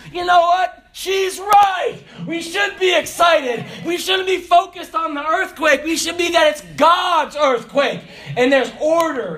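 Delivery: preacher rallying the church to be excited, shouting; shouted preaching. Acted. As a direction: shout it out as the sermon peaks.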